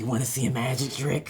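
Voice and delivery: low, soft Joker growl; menacing Joker line. Retold or imitated. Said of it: "Do your Joker".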